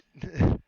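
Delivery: sudden high, loud laugh; loud burst of laughter. Real, not acted. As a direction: burst out laughing at the absurd story. Laughs at Krampus story.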